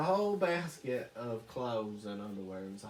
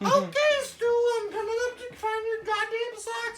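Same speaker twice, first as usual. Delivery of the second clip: much higher, louder ranting shout; ranting wife; 9 dB louder than usual. Retold or imitated. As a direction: tear into the husband. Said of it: "And she's like".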